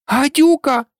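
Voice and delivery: loud high cry of Гадюка!; shouting. A narrator's reading. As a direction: shriek at the intruder. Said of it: "закричала голубка". (крик голубки)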